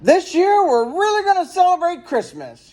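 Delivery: high, bright-eyed announcement; big proud announcement; excited dad announcing. A narrator's reading. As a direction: announce it like a thrilled father. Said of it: "he announced".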